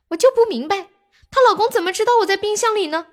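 can't believe he got caught; indignant disbelief. Retold or imitated. Ghost baffled at his death.

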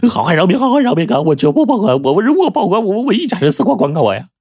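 high-pitched, panicked pleading; desperate begging. A narrator's reading. Bagou kowtows to the robber for mercy, desperately begging. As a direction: tumble over the words, begging for your life. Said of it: "又见他苦苦哀求".

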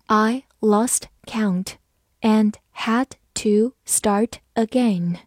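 slow, word-by-word model reading. Shown as a demonstration. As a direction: read it slowly, a gap after each word. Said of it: "好，慢慢来读". (慢慢朗读，逐词停顿)

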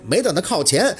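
usual speaking voice

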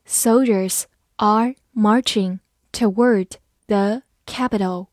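slow, word-by-word model reading; slow dictation-style delivery for learners. Shown as a demonstration. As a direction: read slowly with a gap between words. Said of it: "我们慢慢来读".

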